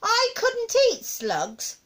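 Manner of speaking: put-on high, slow boyish refusal; high, drawn-out dismay; horrified cry. A narrator's reading. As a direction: protest in appalled child voice. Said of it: "George cried out".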